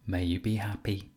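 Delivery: usual speaking voice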